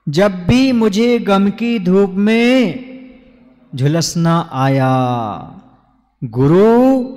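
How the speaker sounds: slow, drawn-out recited verse; stretched vowels in a stately verse cadence; verse recitation. Acted. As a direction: recite it slowly, line by line, with long pauses.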